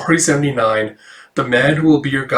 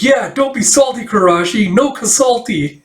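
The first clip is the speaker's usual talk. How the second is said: much higher, playful ribbing; Akane teasing; 9 semitones higher than usual. Acted. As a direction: tease him in a sing-song high voice. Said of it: "And Akane's like".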